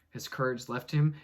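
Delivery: ordinary talk, nothing marked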